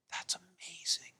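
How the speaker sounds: imitated awed whisper, drawn out; hushed amazement. Guest retells the Cuban driver's reaction, hushed amazement. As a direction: whisper it slowly as the stunned driver.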